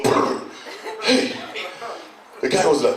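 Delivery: normal talking voice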